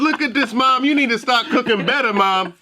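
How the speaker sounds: put-on high whiny kid voice; a child showing off to mom.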